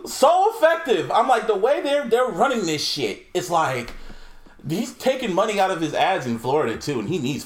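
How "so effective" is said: high, slow, exaggerated awe; mock impressed. Mocking his own reaction to the Biden ad, high and slow. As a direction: draw it out like you're blown away.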